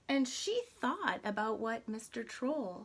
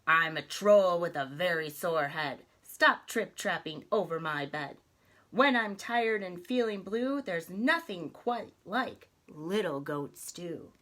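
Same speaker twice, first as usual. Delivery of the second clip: grumpy troll; 7 dB louder than usual. Acted. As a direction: bellow the rhyme like a sore-headed troll. Troll threatens little goat.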